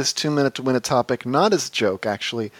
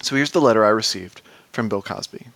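usual speaking voice

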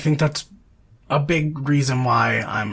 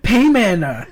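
playful raised call; mock demon hail; 8 semitones higher, 6 dB louder than usual. Joking with co-host about the film's demon, mock hail. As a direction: call it out high, as a mock summons.

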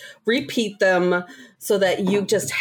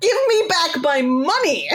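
laughing demand; 10 semitones higher than usual. Retold or imitated. Retold demand to cashier.

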